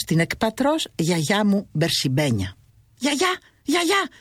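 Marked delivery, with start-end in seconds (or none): 3.0-4.1 high, slow longing call to grandma. A narrator's reading. Narrator calls to his vision of grandma, high and slow. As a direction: call out for her with yearning. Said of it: "φώναξα και έτρεξα με λαχτάρα".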